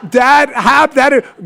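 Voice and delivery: laughing. Real, not acted. Laughing start of story.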